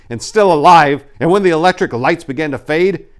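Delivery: loud, high, frantic; rising panic. A narrator's reading. Crowd in dying city lights, loud and frantic. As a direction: push it up into panic.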